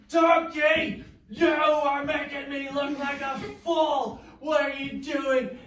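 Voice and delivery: high, loud mock scolding; hammed-up angry shout. Balaam shouts at the donkey that sat down, playfully angry. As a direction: yell at the stubborn donkey for making you look foolish.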